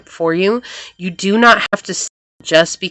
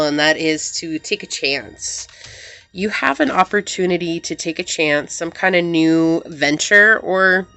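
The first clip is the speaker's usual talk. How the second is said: ordinary talk, nothing marked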